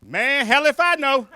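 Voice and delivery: loud, high, slow mock reply; guy hollering back. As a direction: call back across the room, stretched out. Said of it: "the guy on the other side was like".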